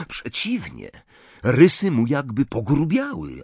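plain reading voice

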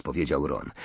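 usual speaking voice

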